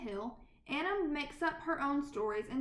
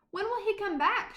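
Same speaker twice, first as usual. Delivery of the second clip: higher, louder pleading question; child nagging mother, higher; eager child; 6 semitones higher, 5 dB louder than usual. Acted. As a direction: ask like an impatient little girl. Anna pestering her mother.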